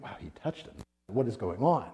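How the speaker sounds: hushed, amazed whisper; astonished whisper. Retold or imitated. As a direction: whisper it in shock. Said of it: "Everyone's like".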